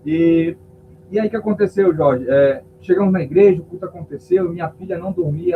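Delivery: ordinary talk, nothing marked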